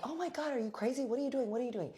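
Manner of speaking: high-pitched alarmed protest, imitated; rattled-off high yelps of disbelief; mock panic. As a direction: imitate someone frantically fending off an attacker. Acted man being beaten, panicked protest.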